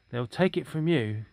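plain reading voice

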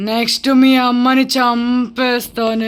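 slow, stretched ghostly menace; threatening ghost. Pretend. Ghost warns child about her mother, slow and menacing. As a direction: drag out the threat like a spirit.

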